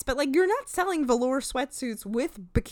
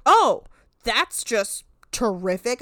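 sarcastic; 6 dB louder, slower than usual. Retold or imitated. Brett sarcastic reply.

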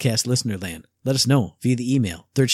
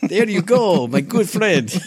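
put-on Russian accent; mock Russian accent; 4 semitones higher, slower than usual. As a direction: friendly fake Russian accent.

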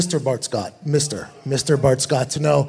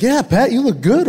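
1 mock teammate praise; 7 semitones higher than usual. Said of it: "a couple guys are like".

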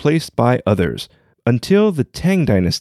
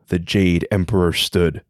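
steady storyteller read over music, lower and calmer than the intro; story narration; 6 semitones lower than usual. Acted. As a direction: tell the legend like a bedtime tale. Story narration with voiced characters.

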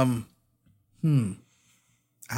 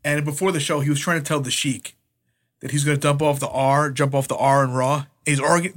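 normal talking voice

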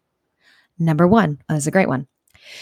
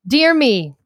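higher-pitched Scottish attempt; attempted Scottish accent; 4 semitones higher than usual. Shown as a demonstration. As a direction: have another go at sounding Scottish. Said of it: "in an aggressively Scottish accent".

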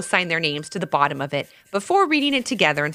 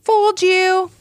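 high, louder gotcha yell; yelled prank; 12 semitones higher, 6 dB louder, slower than usual. A narrator's reading. Levi imagining fooling his sister, gleeful yell. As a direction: shout it gleefully at your sister. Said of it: "when he yelled".

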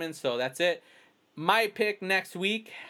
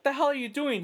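much higher, startled retelling; retold shock; 10 semitones higher than usual. Pushed against a fence by a kid, startled. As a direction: replay the shock of being grabbed. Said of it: "I was like".

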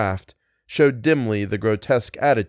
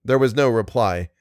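ordinary delivery

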